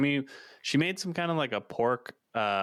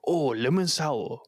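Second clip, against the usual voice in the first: put-on Japanese-accented echo; 5 semitones higher, 4 dB louder, slower than usual. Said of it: "and they go".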